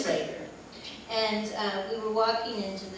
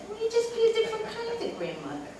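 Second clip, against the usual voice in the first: quick, much higher reassuring voice. Retold or imitated. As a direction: lift her up, gently and fast. Reassures Diane about grandmothering.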